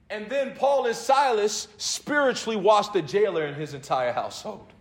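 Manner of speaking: loud, high, driving preacher voice; rising sermon fervour; full-force preaching. Acted. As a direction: preach it hard to the congregation.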